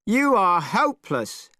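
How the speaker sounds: Monkey losing his temper; angry shout. Acted. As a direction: explode at him, rising on the second hopeless. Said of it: "shouted Monkey, angrier than ever".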